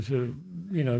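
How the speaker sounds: ordinary talk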